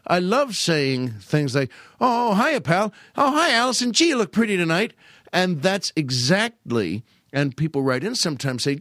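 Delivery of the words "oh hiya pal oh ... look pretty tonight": put-on high, eager radio boy-tenor greeting; knuckleheaded boy. Comic opens the show as a knuckleheaded boy, high. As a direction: play the innocent overgrown kid, pitched up. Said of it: "a tiny bit on the knuckleheaded side".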